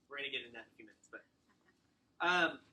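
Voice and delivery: ordinary talk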